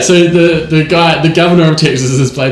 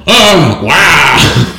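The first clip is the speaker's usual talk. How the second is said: Al Pacino impression; 3 semitones higher, 4 dB louder than usual. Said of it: "Al Pacino".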